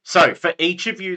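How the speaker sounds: normal talking voice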